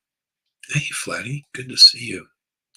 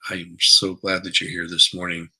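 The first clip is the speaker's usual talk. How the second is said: normal talking voice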